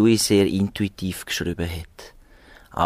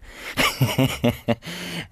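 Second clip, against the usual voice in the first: light, higher-pitched chuckle; amused chuckle; 6 semitones higher than usual. Real, not acted. Composer chuckles after admitting early scepticism, amused laugh. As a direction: chuckle at your own remark.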